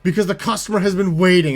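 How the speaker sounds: mock an irate coworker demanding help over the intercom; imitating aggressive cashier. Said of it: "aggressively just being like".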